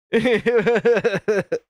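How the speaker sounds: amused, bubbling laugh; real laugh. Real, not acted. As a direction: laugh in a quick run of chuckles. Amused at E T likeness.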